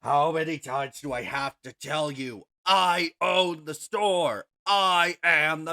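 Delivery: loud, exasperated orc shopkeeper; male orc voice. Acted. Orc store owner answering a demanding customer, loud exasperated voice. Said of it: "you hear a male orc voice saying".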